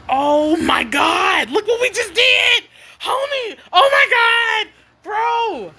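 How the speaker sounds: re-enacted high, drawn-out cry of amazement; thrilled reaction. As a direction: relive the triumph with high, stretched exclamations. Retells thrilled reaction to finished mix.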